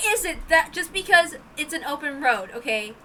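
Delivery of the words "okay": usual speaking voice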